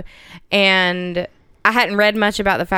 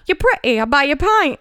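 higher, hammed-up British pickup line; British accent; 6 semitones higher than usual. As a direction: flirt in a mock British accent. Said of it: "favorite British phrase".

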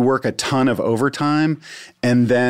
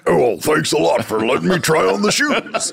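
lightly voiced bear, through a laugh; a hint of a cartoon bear talking; 5 semitones higher than usual. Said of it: "That was my bear voice".

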